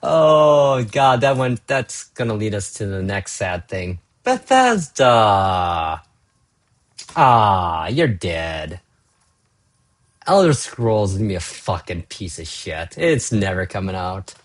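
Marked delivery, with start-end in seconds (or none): 4.2-8.8 long groaning call of the name; drawn-out lament. Real, not acted. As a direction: stretch the name out slowly in dismay. Dramatic lament of studio.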